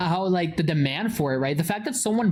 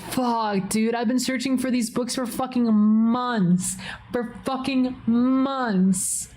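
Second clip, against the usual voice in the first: higher, stretched-out "months"; exasperated lament; 4 semitones higher, slower than usual. Retold or imitated. Streamer laments months of searching, exasperated. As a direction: drag out the last words in frustration. Laments lost books, retold.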